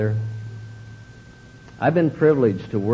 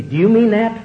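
a pointed, higher and louder hurt question; wounded question; 10 semitones higher, 5 dB louder than usual. Retold or imitated. Wife asking her husband if he meant the sting, hurt and pointed. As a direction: ask it as if stung.

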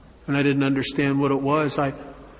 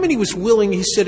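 ordinary delivery

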